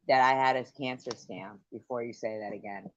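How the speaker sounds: normal talking voice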